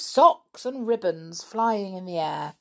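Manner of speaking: usual speaking voice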